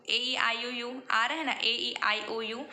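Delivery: usual speaking voice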